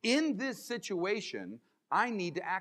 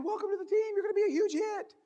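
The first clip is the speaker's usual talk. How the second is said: much higher, bright mock-friendly greeting; cheerful welcome; 12 semitones higher than usual. Shown as a demonstration. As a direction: beam it at the new hire. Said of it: "Didn't you hear my words?".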